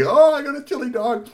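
high, loud delighted cry; thrilled. Acted. Boy getting his chili dog, high thrilled cry. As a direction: gush over the prize.

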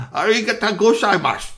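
high, loud Japanese thanks; Japanese phrase. Acted. Power Ranger thanking the witch in loud Japanese. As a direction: proclaim it boldly in Japanese. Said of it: "his Japanese wasn't so good".

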